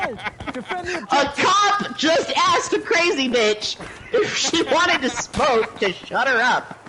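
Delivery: cracking up in disbelief; incredulous laughter. Real, not acted. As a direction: tell it through laughter, amazed. Laughing in disbelief at own story.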